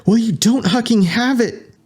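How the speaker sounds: very high angry shout. Acted. Candy shouting back at Winston through comm, angry high. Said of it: "Candy shouted aloud".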